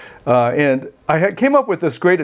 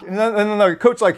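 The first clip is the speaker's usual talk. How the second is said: usual speaking voice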